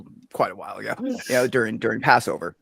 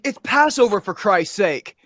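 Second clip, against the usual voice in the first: high, loud fed-up yell; exasperated shout; 7 semitones higher, 8 dB louder, slower than usual. Retold or imitated. Lady arguing with the store clerk over wine, exasperated shout. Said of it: "It's like".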